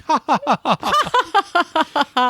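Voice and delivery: high, hammed-up canned laugh; mock her laugh over the top; fake laugh. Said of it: "And you'll be like".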